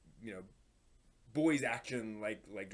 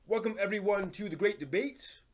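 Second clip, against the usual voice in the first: show host welcome; 7 semitones higher, 4 dB louder than usual. Acted. Opening his debate segment.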